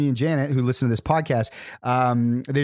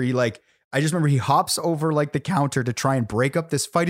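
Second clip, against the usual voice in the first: ordinary talk, nothing marked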